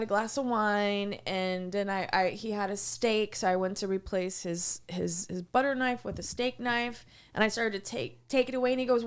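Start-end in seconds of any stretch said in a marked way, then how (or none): none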